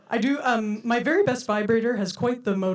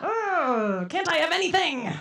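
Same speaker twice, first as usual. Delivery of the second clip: whiny comeback; 8 semitones higher, slower than usual. Retold or imitated. Her whiny retort to mom.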